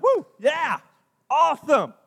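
high, loud whoop of joy; a pumped-up Moses whooping; excited cheer. Acted. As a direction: cheer it like great news. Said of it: "He's excited".

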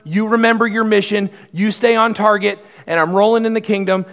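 a booming, encouraging God cheering his people on; voicing God, mock-reassuring. Preacher voicing God to a struggling congregation, reassuring and loud. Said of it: "God has been saying".

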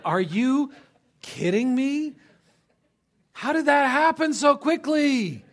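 high, loud, incredulous outburst; exasperated parent. Acted. Parent finding the child licking the store floor, exasperated shout. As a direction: cry out in hammed-up disbelief. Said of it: "exasperation that every parent understands".

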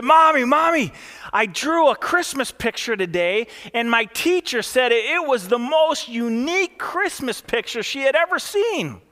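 put-on high, eager child's voice, loud on the first Mommy; a kid bursting through the door with news; excited little girl. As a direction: bubble over like a proud little girl. Child quoted in a joke story.

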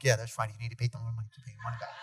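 fast, softening into a whisper; meek sidekick echo. Retold or imitated. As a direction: echo him timidly, trailing off. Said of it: "I'm like".

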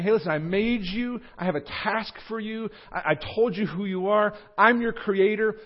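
high, loud, emphatic charge; God. Acted. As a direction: deliver a rousing commission, high and forceful. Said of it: "This is actually God saying".

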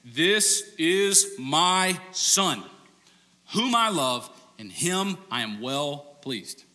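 mock Mufasa voice over the crowd; booming Mufasa voice. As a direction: boom it like God from the clouds. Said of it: "God goes full-blown Mufasa vo".

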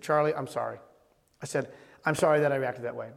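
low, soft retold apology; subdued, sincere and low; gentle apology. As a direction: apologize quietly to a child. Retold apology to his son.

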